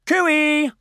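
high, loud cooey; calling out. Pretend. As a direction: sing it out to hail the listeners. Host calls out to open show.

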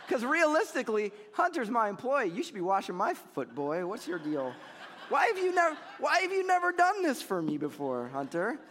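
high, loud, hammed-up entitled boss; mock bossy boss. Pastor teasing his employee Hunter, a hammed-up bossy boss. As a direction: play the demanding boss, laughing at the joke.

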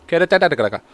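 Catatalagitica said boldly, as if sure it is right; said with confidence. Shown as a demonstration. Reading out a hard Italian town name, with mock confidence. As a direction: commit to Catatalagitica, no hesitation. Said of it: "I'm saying it with confidence".

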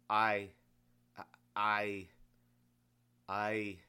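stumble, lost for words; stammering. Acted. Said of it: "the man stammered".

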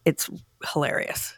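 breathy whisper of hilarious. Real, not acted. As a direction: drop to a whisper for the punchline.

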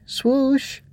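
put-on high-pitched childish whine; whining. A narrator's reading. Ava pokes the silent Growbug again, whining. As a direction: whine the name, worried. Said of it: "She poked again and whined".

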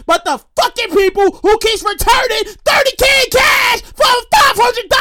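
rising high-pitched incredulous rant; worked up. Real, not acted. Ranting about people returning cash, worked up. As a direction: build to a strained, exasperated peak. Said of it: "I get a little excited".

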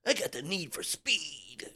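slow, soft, drawn-out catchphrase; hyped-up moviegoer. Acted. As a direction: mimic a thrilled fan savouring the line, hushed and stretched. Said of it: "the boys left there in eighty-six and was like".

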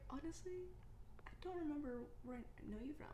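soft, understated confession; honest admission. Acted. Friend dictating an honest text reply, softly candid. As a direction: own up quietly, mock-candid. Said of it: "Well, then just be honest".

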